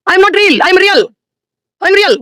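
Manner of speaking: lifted high and bright, almost crying out; excited high exclaim. Acted. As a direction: proclaim it up high. (உயர்ந்த குரலில் உற்சாகக் கூவல்)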